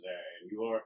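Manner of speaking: flat, cooler greeting, lightly voiced; a touch of disinterest toward the stranger. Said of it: "with far less enthusiasm".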